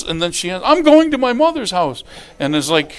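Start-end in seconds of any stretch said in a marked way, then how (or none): 0.6-2.0 high, loud mock threat; hammed-up indignant exit; storming-out wife. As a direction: announce it as the fight's last word. Wife storming out of acted quarrel.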